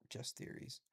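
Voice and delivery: whispered trailing aside. Real, not acted. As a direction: drop to a whisper.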